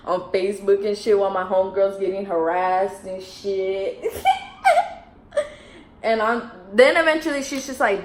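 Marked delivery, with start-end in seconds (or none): 4.1-5.0 short, high-pitched laugh; amused high laugh; high giggle. Real, not acted. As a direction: let out a quick high giggle. Laughs mid-story about harassed friend.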